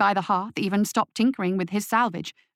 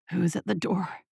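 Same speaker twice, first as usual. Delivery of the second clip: ragged voice; 2 semitones lower, 5 dB quieter than usual. A narrator's reading. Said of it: "a ragged voice echoed from the hearth".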